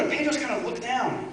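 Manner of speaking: normal talking voice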